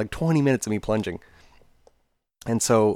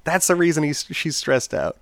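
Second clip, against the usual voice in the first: higher, louder mock lecture; lightly voiced scolding dad; 8 semitones higher, 5 dB louder than usual. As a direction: chide the kids for not helping. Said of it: "and he was like".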